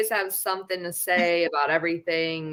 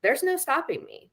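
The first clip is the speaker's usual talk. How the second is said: higher, defiant self quote; badass attitude; 8 semitones higher than usual. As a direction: toss it off like nothing can stop you. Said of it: "that badass attitude where you're like".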